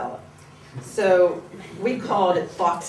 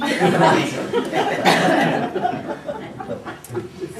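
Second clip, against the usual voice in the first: high airy laugh trailing off; breathy laugh; 10 semitones higher, 4 dB louder than usual. Real, not acted. Laughing after being caught.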